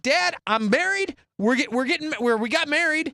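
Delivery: high, excited imitation of a son; acted announcement. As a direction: blurt the big news to dad. Son announcing marriage to dad.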